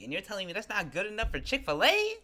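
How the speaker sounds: indignant, rising "you're telling me" challenge; incredulous. Real, not acted. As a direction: end high in offended disbelief. Venting about being rejected.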